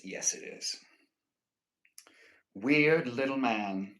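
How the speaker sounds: ordinary talk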